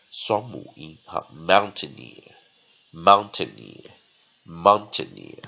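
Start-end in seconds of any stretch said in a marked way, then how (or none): 1.3-5.4 "mountaineer" slow and clear, weak middle syllable; model pronunciation. Shown as a demonstration. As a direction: drill "mountaineer" emphatically as one whole word. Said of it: "双弱音哈".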